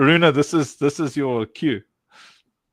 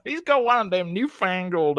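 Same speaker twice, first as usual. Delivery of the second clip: put-on Southern US accent; 7 semitones higher than usual. Host continuing his crypto bit for laughing friends, Southern accent. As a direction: put on a high Southern US twang. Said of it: "now do it in a Southern U S accent".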